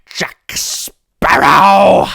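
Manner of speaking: very drawn-out, high, booming villain shout; Davy Jones. Shown as a demonstration. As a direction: stretch the name out loud as a sea devil. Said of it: "You do it".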